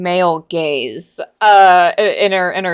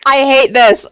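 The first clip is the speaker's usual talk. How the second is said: exasperated outburst; 6 semitones higher, 12 dB louder than usual. Real, not acted. Exasperated reading the book.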